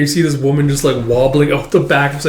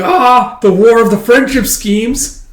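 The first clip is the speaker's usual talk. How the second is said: put-on much higher, louder kid voice; knowing little girl; 9 semitones higher, 7 dB louder, slower than usual. Anya commenting on the rival schemes, high knowing kid voice. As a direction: pronounce it like a girl who sees the whole plot.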